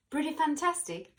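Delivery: plain reading voice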